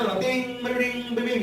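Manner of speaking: high ding-dong bell sounds; imitated church bells. Preacher mocking Sunday mass, imitating the ringing bells. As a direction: ring it out like mass bells, playfully.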